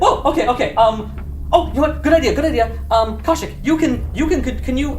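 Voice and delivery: high, hasty backpedal; startled NPC. Acted. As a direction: placate the axe-wielder fast. NPC reacting to axe threat.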